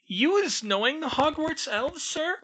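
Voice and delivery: very high squeaky elf voice, wondering; a tiny servant astonished; amazed house elf. Acted. House elf learns of Hogwarts elves, squeaky and amazed.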